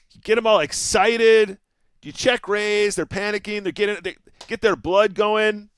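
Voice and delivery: loud, high, fired-up pep talk; hyped-up. Real, not acted. Poker streamer telling chat how you get 'em, hyped and loud. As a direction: crow it to the crowd, pitched high and punchy.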